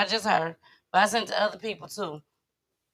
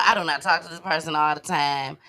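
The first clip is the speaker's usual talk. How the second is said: ordinary delivery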